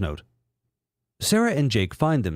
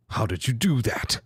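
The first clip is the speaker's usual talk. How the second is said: villain voice. Acted. Villain Mew three.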